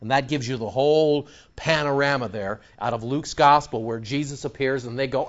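usual speaking voice

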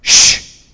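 sharp unvoiced shush. Real, not acted. Preacher hushing the noisy people outside, sharp shush. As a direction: hush the room with one quick shh.

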